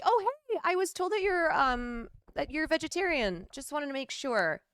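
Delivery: sweetly checking a dinner guest's diet; fake-polite hostess, bright and quick; mock hostess.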